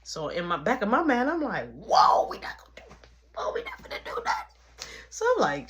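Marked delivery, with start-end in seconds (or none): 1.7-4.6 half-whispered inner exclamation; hushed, startled aside to yourself; airy, astonished whoa; mock shock.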